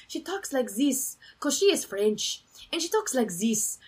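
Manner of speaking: hammy French with "zis" for "this"; fake French accent. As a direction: put on a phony French accent. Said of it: "the most fake, like, French accent".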